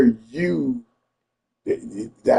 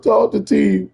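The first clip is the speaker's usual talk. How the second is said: higher-pitched imitation of a crying woman; mock crying; 7 semitones higher, 3 dB louder than usual. Crying woman hands over the phone, mock tearful. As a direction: imitate her tearful plea, half in earnest. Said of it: "she was crying about that fucking wig".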